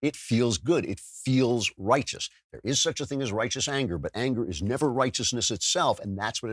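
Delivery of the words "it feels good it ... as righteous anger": normal talking voice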